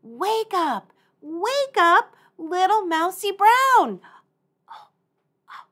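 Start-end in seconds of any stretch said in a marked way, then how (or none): 0.0-4.0 calling out. Shown as a demonstration. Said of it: "Here we go".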